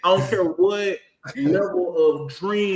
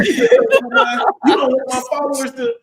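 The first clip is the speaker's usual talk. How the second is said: higher, louder, slow whine with a laugh; mock whiny influencer; 7 semitones higher, 7 dB louder, slower than usual. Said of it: "They're like".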